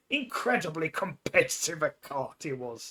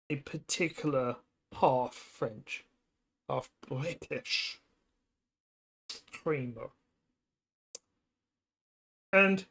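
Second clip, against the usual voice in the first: ordinary delivery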